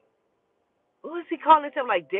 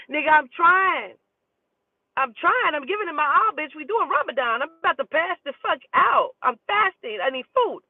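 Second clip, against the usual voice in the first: pitched-up frustrated venting, fast; exasperated rant; 7 semitones higher than usual. Real, not acted. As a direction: vent the hunger complaint with rising exasperation. Ranting about Ramadan fasting.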